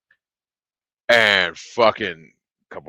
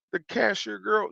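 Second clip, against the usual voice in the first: ordinary delivery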